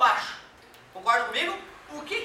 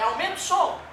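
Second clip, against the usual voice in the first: everyday request; 6 semitones higher, 5 dB louder than usual. Retold or imitated. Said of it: "é clássica essa fala".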